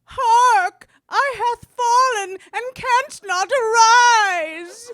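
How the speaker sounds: loud mock-Shakespearean distress call; hammed-up cry for help. Actor playing a fallen elder calls out in a parody commercial, hammed-up shout. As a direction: wail it out theatrically at full voice.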